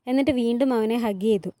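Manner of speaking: ordinary delivery, nothing marked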